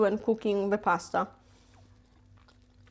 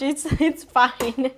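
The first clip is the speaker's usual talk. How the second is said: ordinary talk, nothing marked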